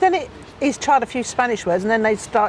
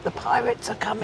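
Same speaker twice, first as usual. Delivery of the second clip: soft, breathy ghost-story whisper, much quieter; spooky whisper; 6 dB quieter than usual. Pretend. Pointing out a pirate ship to husband, eerie whisper. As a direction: hush it like a spooky secret.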